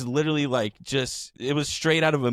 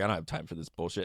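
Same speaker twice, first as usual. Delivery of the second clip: lower, softer, fast brush-off; dismissive rich guy; 5 semitones lower, 6 dB quieter, faster than usual. Retold or imitated. Bar patron brushing off the bartender, low quick dismissal. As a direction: toss it off low and quick like you can't be bothered. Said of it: "he's like".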